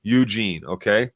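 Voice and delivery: ordinary talk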